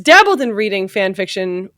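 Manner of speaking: ordinary delivery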